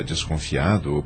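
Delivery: ordinary talk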